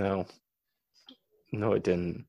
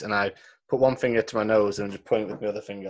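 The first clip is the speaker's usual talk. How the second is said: ordinary talk, nothing marked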